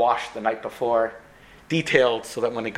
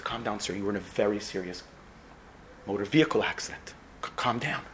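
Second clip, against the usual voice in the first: softer, lower voice to calm a patient; soothing nurse; 5 semitones lower, 8 dB quieter than usual. Acted. Nurse to a waking patient, soft and low reassurance. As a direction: settle him down gently and low.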